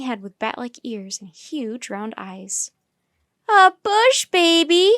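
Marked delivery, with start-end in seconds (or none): 3.5-4.9 high, loud, drawn-out squeal of delight; excited child's exclamation; excited cry. A narrator's reading. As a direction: cry out, thrilled by the little animal. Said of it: "cried Annie".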